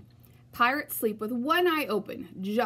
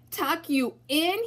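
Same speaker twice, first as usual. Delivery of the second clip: bellow; 6 semitones higher, slower than usual. A narrator's reading. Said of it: "he bellowed".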